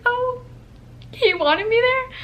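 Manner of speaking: high, drawn-out disbelief; retold swooning surprise; touched surprise. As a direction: melt into a high, slow question. Acts out her touched, surprised reaction.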